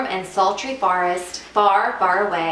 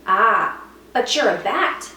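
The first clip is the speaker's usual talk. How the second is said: high, louder puzzled voice; bold stranger bat; 9 semitones higher, 4 dB louder than usual. Acted. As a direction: ask it with loud curiosity.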